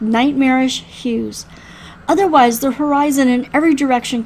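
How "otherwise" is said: usual speaking voice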